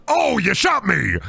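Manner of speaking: slow hammed-up yell of pain; mock shout. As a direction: overact getting shot, slowly. Mock wrestler selling a shot.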